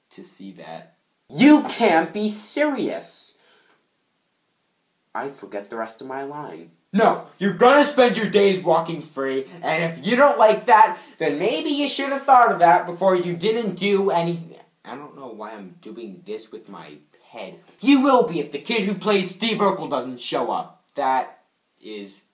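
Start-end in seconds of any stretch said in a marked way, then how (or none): 1.3-3.4 loud, high, drawn-out incredulity; outraged disbelief. Acted. Acted outraged disbelief in a take. 6.9-14.6 loud, high-pitched scolding tirade; acted angry rant. 17.8-20.7 loud, high, overdone serious threat; way too serious. Acted. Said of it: "way too serious".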